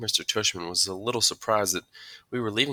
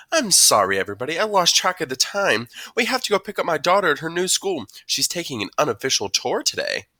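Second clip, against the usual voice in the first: higher, louder voice for a mom excusing herself; apologetic mom; 9 semitones higher, 7 dB louder than usual. A narrator's reading. Mom excusing the family to the room, apologetic. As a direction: play a mom making brisk, public apologies.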